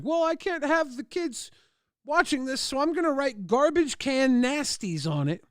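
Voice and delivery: lightly voiced mom laying down the rule. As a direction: play the fussy sensible mother. Mom deciding about the tape.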